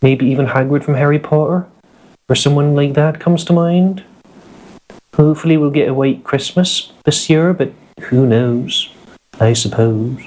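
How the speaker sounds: West Country accent, pitched well up. Shown as a demonstration. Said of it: "West Country accent".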